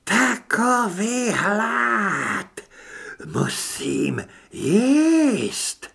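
high, hugely drawn-out ravenous wail; creaking creature. A narrator's reading. Starving parasite howls for food, creaking and drawn out. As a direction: wail with monstrous hunger, stretching each word. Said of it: "Hlas skřípal, nebyl na rozhovory zvyklý".

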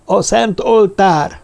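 high, slow chanted answer of a crowd; chorus of druids calling out; druids in unison. A narrator's reading. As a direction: drone it out as one voice, high and slow. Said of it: "egyemberként zúgták a választ".

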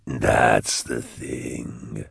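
low, slow demon purr; deep voice, every word drawn out; Cherubael. A narrator's reading. Cherubael answers the man who summoned him, slow and eerie.

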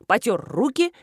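ordinary delivery

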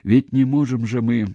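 high, taunting echo of his plea; mocking mimicry. A narrator's reading. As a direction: parrot his whining excuse back at him. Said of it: "передразнила она".

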